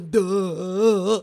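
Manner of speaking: loud, long dopey "duh"; hammed-up stunned groan; dumbfounded audience. Host imitates everybody at the Oscars, mock stunned. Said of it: "You see their faces like".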